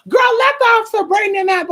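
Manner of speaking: high, squeaky impression of the chatty friend; mocking friend voice. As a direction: ham up the friend butting in from the phone. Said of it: "my friend up front talking about".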